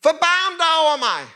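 high, loud desperate plea; crying out loud. Retold or imitated. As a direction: call out to be heard over a crowd. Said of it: "Højere og højere råbte han".

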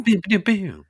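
high, loud zapping pews; imitated pew noises. Co-host joining in on the drill noises, high pews. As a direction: fire off high-pitched pew sounds.

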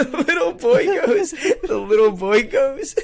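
high, laughing through the words; cracking up mid-story; real laughter. Real, not acted. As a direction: struggle to get it out through laughter. Cracking up while telling the story.

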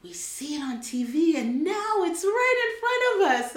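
high, loud amazed voice; excited locals. Retold or imitated. African locals marvelling at an American woman, excited. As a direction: gush like an amazed stranger. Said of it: "They're like".

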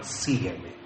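low, very soft murmur to a sweetheart; enamorado. Acted. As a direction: drop the voice low and soft, wooing her. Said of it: "Cuando se enamoraron".

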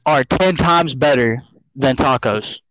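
slow, low reveal; slow dramatic. Real, not acted. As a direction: drag it out for drama. Dramatic hot take reveal.

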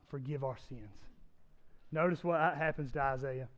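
quiet prayerful preaching. Acted. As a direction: lean in and pray quietly for everyone. Quiet prayerful close of sermon.

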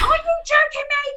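squeaky high, stunned disbelief; shocked disbelief. Retold or imitated. Telling her agent, she squeals in shocked disbelief. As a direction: act like you can't believe your luck. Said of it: "I go to my agent".

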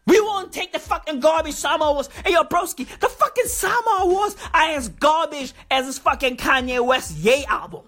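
ordinary delivery

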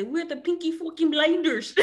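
hammed-up Irish accent. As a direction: put on an Irish gangster's accent. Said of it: "like, Ireland. They're, like, super".

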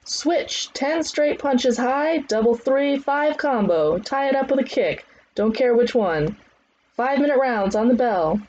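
high, projected commands; trainer's drill calls. Acted. Trainer calling leg drills on bell, high commands. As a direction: drive the class into the next round.

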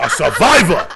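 laughing loud punchline; laughing exclaim. Real, not acted. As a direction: crack up while yelling it.

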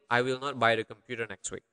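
fast, joined-up sentence without gaps; quick run-through. Shown as a demonstration. Teacher repeating the full translation, quick run-through. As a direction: run the whole sentence together briskly.

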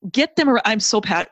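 loud, passionate burst; real passion spilling over mid-thought; fired up. Real, not acted. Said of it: "I'm getting fired up".

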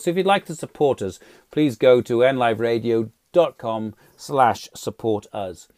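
radio outro. Acted. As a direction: sign off the show like a station promo. Host's closing station promo.

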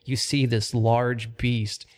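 steady, measured scene-setting; lightly dramatic dungeon description; game master narration. Acted. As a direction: paint the chamber for the players. GM sets the scene.

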